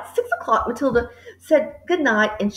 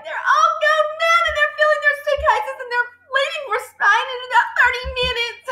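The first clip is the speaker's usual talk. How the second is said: put-on high, frantic child's rush of news; distraught girl gasping her news; breathless cry; 12 semitones higher than usual. A narrator's reading. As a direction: blurt it out, upset and winded. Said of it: "she cried".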